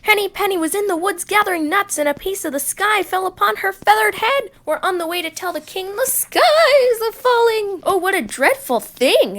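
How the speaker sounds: high, quick turkey voice; alarmed turkey. Acted. Turkey Lurkey telling Foxy Loxy the sky is falling, high and quick. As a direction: blurt the news in a hurry.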